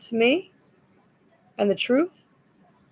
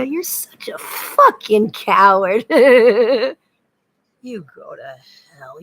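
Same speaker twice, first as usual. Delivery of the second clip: slow whispered contempt, spat out; breathy hissed scorn; harsh whisper; 9 dB louder, much slower than usual. Real, not acted. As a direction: whisper the insult with disgust. Hissed insult at an absent man.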